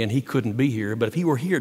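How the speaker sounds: normal talking voice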